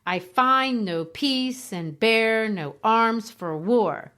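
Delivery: slow, with every other syllable of "I find no peace and bear no arms for war" punched; exaggerated iambic stress. Shown as a demonstration. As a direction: stamp out the iambs of "I find no peace". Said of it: "If I say it really exaggerated".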